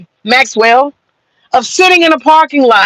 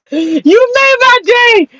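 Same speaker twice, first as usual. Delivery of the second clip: laughing; 8 semitones higher, much slower than usual. Real, not acted. Still laughing at the troll.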